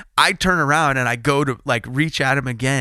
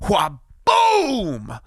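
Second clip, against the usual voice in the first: a sharp, higher mock impact cry; crash noise; 8 semitones higher than usual. Racer mimics the rider slamming into him with a mock crash cry. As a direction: burst out the crash sound.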